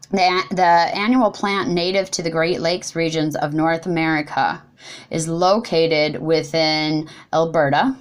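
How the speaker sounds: ordinary delivery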